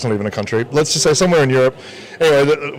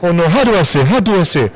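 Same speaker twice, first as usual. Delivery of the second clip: put-on African accent; 6 semitones higher than usual. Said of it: "my really stereotypical African accent".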